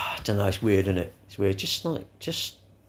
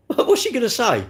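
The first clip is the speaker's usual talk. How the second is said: pitched way up, mocking a nervous mate; mock nervous lad; 11 semitones higher, 3 dB louder than usual. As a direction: play the scared lad, voice high. Acting out mate's dialogue.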